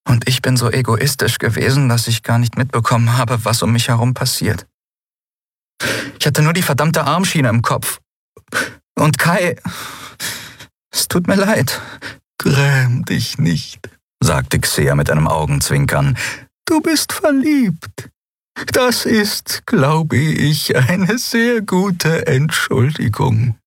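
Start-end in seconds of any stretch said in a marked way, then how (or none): 0.1-11.8 higher, rueful apology; young Endriel, ashamed. Acted. Endriel apologizing to Xea for her selfishness, ashamed. 12.4-13.9 mild comfort. Acted. Xea consoling. 16.6-23.6 very high, slow, playful; teasing old healer. Acted. Xea gently teasing Endriel about being in love, playful.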